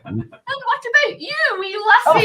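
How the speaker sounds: very high, loud, slow singsong; imitated cooing adult. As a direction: coo at a shy little girl, hammed up. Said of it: "And they'd be like".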